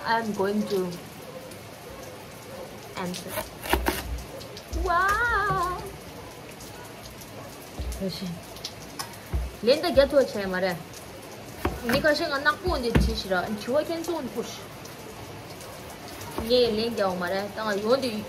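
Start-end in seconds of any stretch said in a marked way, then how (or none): none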